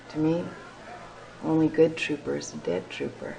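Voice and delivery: usual speaking voice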